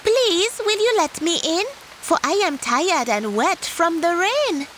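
high small pleading tortoise; high tortoise begging politely. Acted. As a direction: ask in a high little voice, tired and wet. Tortoise begs entry.

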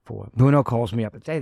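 usual speaking voice

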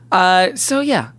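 ordinary talk, nothing marked

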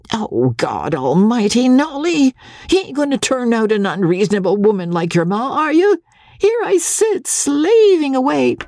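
put-on high-pitched, worked-up old captain; harried, high-voiced exasperation; exasperated father. A narrator's reading. Harried father snaps back at daughter.

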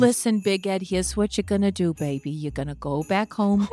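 low, tender voice for the late wife; mock Abby's voice.

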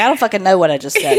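fast, giggling mock confusion; a mock-baffled line broken by laughter; laughing through it. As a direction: rattle it off while laughing. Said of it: "I'm like".

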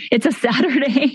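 usual speaking voice

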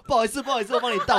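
high, loud and hurried, laughing through the apology; flustered apology. Retold or imitated. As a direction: play the waiter rushing to say sorry, voice up high. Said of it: "然后他就跟我说".

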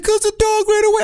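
very high, whiny mock-crying kid; squeaky tearful child impression. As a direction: imitate a child wailing the answer. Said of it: "Madison is crying in the room".

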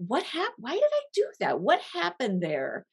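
mock bewilderment at yourself; baffled self-question. Looking back on acting out, asking herself in high mock bafflement. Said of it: "we're like".

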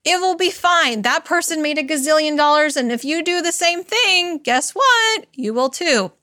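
mock the hustle cheerleader in your head; pep-talk pusher. Inner hustle voice urging herself on, hammed-up high hype. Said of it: "you're like".

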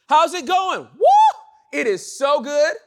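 high, loud, whooping cheer; cheerful believer whooping hello; joyful greeting. Retold or imitated. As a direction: burst out with delight.

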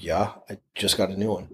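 low, soft, shifty reply; quiet evasive mumble. Retold or imitated. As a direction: mumble it like you're hiding something. Trevor's evasive reply retold.

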